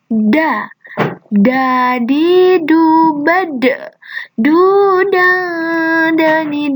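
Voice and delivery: slow chant, tongue pressed to the palate; teacher modelling the da letter. Shown as a demonstration. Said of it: "lidahnya menempel di langit-langit atas".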